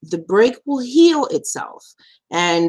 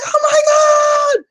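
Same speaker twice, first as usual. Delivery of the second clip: mock shock; 5 dB louder, much faster than usual. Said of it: "everyone pointed and was like".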